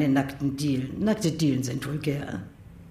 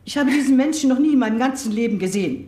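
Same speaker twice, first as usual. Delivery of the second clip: indignant denial; 5 semitones higher than usual. Acted. Old mother indignantly denying that this man is her son. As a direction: fire it out in disbelief.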